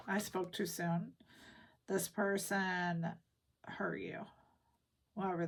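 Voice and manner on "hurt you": whispering. Real, not acted. Hushed about past hurt.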